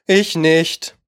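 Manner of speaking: ordinary delivery, nothing marked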